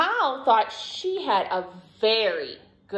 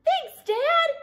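high, loud excited thanks; delighted Douglas; 5 dB louder than usual. A narrator's reading. Douglas thanking Dad for the hat, high delighted voice. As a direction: squeal the thanks happily. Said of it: "said Douglas".

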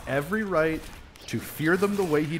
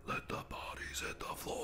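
mock menacing whisper; 16 dB quieter than usual. Gamer about to take out one last guy, mock menacing whisper. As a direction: whisper the lyric like a creeping killer.

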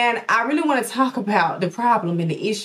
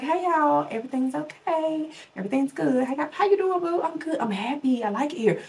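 a hint of forced brightness, higher and softer; fake cheerful front; 6 semitones higher, 5 dB quieter than usual. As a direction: pretend all is fine for the camera, lightly voiced. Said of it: "I'd be like".

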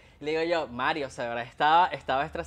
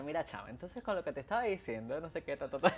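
chatty aside; 3 semitones lower, 9 dB quieter than usual. Retold or imitated.

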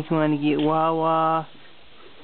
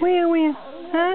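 high, bouncy repeated where; 10 semitones higher, much slower than usual. Real, not acted. Playful baby game.

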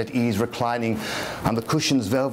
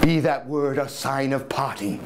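plain reading voice

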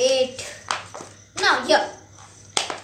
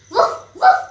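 high playful woof woof; dog bark imitation; 8 semitones higher, 4 dB louder than usual. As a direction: bark like a puppy for the baby.

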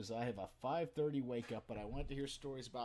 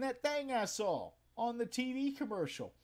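put-on drunk customer; 11 semitones higher, 4 dB louder than usual. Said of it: "And he says".

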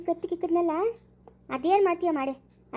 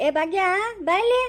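raised call rising high and loud; 4 semitones higher, 3 dB louder than usual. Acted. Parent calls Bhagya to come here, calling out. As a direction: summon someone from another room.

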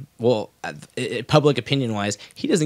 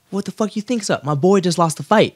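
angry retort; 7 semitones higher than usual. Retold or imitated. Diaz retort retold.

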